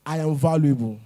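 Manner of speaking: loud firm declaration; declaration spoken aloud. Shown as a demonstration. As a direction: proclaim it to yourself, full voice. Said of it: "I want you all to repeat with me".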